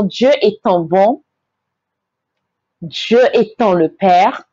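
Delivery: ordinary talk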